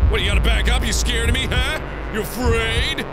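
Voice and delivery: high, loud goading with pushy questions; mocking taunt. Player mocking ghosts that back away, jeering taunt.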